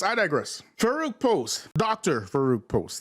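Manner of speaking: usual speaking voice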